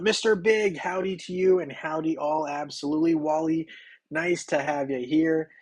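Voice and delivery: normal talking voice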